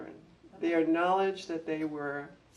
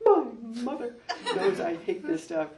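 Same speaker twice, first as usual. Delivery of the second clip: higher, louder mock wailing child, half laughing; imitating hysterical boy; 7 semitones higher, 8 dB louder than usual. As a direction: retell the sobbing boy, laughing through it. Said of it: "crying his eyes out and upset and stamping and hysterical".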